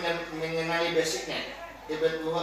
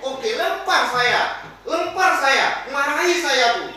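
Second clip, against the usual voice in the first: loud, high defiant outburst, slow at first; a hurt child daring them to throw; angry child; 7 semitones higher, 6 dB louder, much slower than usual. Acted. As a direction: pupil shouting at the teacher, angry. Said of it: "Dia marah".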